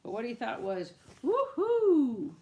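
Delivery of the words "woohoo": high, loud whoop of joy; excited cheer. A narrator's reading. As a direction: cheer like a thrilled duck. Duck cheers on tractor.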